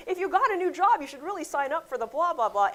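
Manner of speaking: high, loud know-it-all advice; hammed-up pushy commenter; mock pushy advice. Commenter pushing unwanted advice, loud mock voice. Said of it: "Or like".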